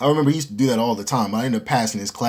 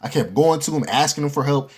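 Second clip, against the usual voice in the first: usual speaking voice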